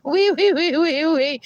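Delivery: high, loud squeals; playful high-pitched wees; squealing wee. Pretend. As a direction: squeal like a piggy.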